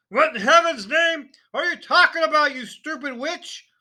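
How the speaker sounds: loud, high furious shout; angry shout. A narrator's reading. Father shouting at wife and daughter, loud and furious. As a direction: roar it in outrage. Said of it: "Mister Wormwood shouted".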